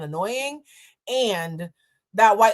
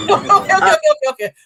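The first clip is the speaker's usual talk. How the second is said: okays squeezed out through laughter, higher and louder; shocked laughing; 10 semitones higher, 8 dB louder than usual. Real, not acted. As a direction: laugh in disbelief at the escalation. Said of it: "I'm laughing 'cause I'm shocked".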